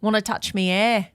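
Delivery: put-on playful Aussie accent, vowels broadened; Australian accent. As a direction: ask it in a mock Australian accent. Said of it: "I love to talk with an Australian accent".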